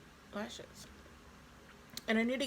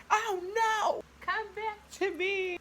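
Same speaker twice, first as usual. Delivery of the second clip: dramatic plea; 13 semitones higher, 5 dB louder, much slower than usual. Real, not acted. Lights go out, calling them back.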